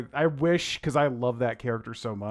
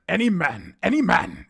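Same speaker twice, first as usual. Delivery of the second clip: louder, higher hammed-up Tywin attempt; too comedic Tywin; 7 semitones higher, 8 dB louder than usual. Shown as a demonstration. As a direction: overdo the stern lord voice. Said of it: "It sounds too comedic".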